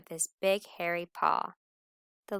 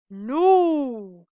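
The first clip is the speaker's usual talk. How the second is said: loud, high howled no; 6 semitones higher, 12 dB louder than usual. Acted. Wolf kissed by his dinner, loud howl. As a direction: howl it out in protest. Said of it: "howled the wolf".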